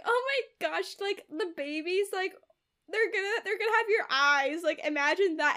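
lightly voiced giddy father; mock gushing. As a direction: coo it like a besotted dad. [name] cooing about the babies.

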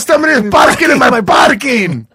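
mild rant building to a shouted last line; accented angry complaint. Retold or imitated. Comedian voicing an isis guy complaining about parking, shouting. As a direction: put on an accent and yell at the end.

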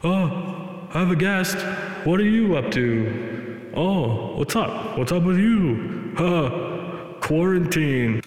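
drawn-out high voice mimicking an echoing podcast host; echoey podcast voice. Mocking podcast hosts with bad echo, high and drawn out. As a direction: stretch each word slowly like it bounces around a room. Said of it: "they're like this".

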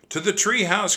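high, loud urgent cry; rally your sister to flee. A narrator's reading. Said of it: "cried Jack".

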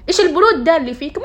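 high, loud, fast shocked outburst; shocked friend. Retold or imitated. Friend shocked at how calm she is, high and loud. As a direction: play the friend stunned by her coolness. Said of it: "فكانت جد مصدومة".

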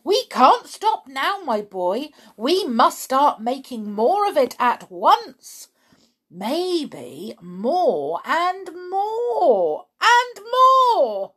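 high, loud and excited, building to drawn-out cries; swelling, gleeful rant of an overexcited father; excited dad. A narrator's reading. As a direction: get carried away with a grand scheme.

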